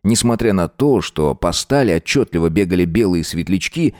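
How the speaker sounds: ordinary delivery, nothing marked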